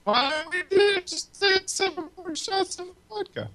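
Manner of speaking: glitchy robotic auto-tune sound; auto-tuned voice. Real, not acted. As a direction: voice warped by lag into auto-tune. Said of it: "Was he auto-tuned?".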